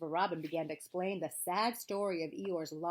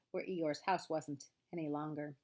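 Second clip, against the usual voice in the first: ordinary delivery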